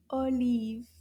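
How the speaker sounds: usual speaking voice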